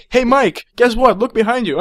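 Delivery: lightly acted hero springing a trick; good guy's taunt. Acting a good guy tricking him.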